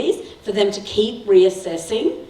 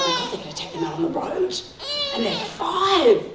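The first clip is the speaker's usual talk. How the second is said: high, fast mock-panicked squeal; 11 semitones higher than usual. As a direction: play your past self freaking out, pitched way up. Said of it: "it was like".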